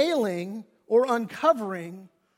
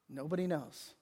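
dropped low and soft; deadpan; 6 semitones lower, 13 dB quieter than usual. Real, not acted. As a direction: answer it dry and quiet. Deadpan answer after questions.

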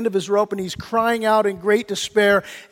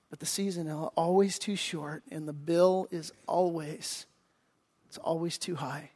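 much softer, weighty warning; dropped to a hush; 4 semitones lower, 12 dB quieter than usual. Real, not acted. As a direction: let the warning sink in quietly. Sermon punchline dropped soft.

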